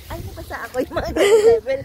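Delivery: surprised. Real, not acted. Surprised by getting wet.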